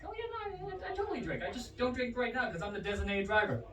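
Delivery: high, hedging cover story; high and soft at first, settling as the excuse lands; bluffing excuse. Acted. Voicing his cover story to friends.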